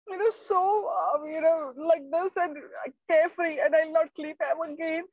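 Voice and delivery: high, crying plea for mercy; trapped lizard pleads with the snake, sobbing; tearful begging. Acted. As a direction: beg for your life through tears.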